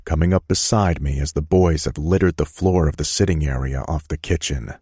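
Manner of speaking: usual speaking voice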